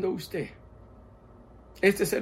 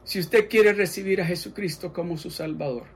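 ordinary talk

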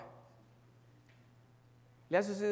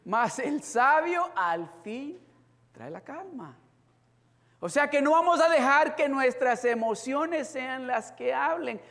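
raised sermon emphasis; preaching; 7 semitones higher, 7 dB louder than usual. Acted. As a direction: drive the lesson home. Preaching the verse.

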